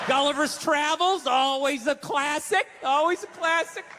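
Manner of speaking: slow, drawn-out mock-contented voice; mocking impression of a placid husband; even-keeled husband. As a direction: savor each word as the agreeable husband. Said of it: "this Mister Even-keeled all the time".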